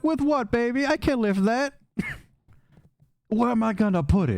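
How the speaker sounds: high, sassy voice, whiny refusal; Dahlia's high voice. Acted. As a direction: brush him off in a high sing-song.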